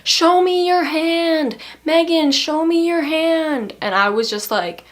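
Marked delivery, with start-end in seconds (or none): 0.0-3.7 high, slow, louder insistent demand; dog owner demanding to see Megan's hand, high and slow; dog owner's voice. Retold or imitated. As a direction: pitch it way up and drag it out, demanding. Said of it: "And she's like".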